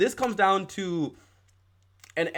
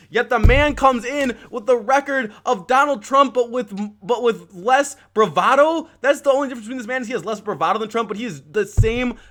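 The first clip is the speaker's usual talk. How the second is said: heated rant; 6 semitones higher, 4 dB louder than usual. Real, not acted. Escalating rant about Bloomberg.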